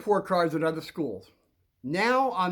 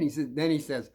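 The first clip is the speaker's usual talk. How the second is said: ordinary delivery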